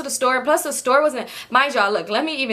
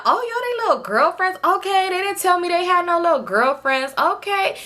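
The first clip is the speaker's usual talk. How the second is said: higher, playful mock surprise from an older woman; teasing lady; 8 semitones higher than usual. Lady at the party teasing the girls, playful mock surprise. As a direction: tease the little girlfriends.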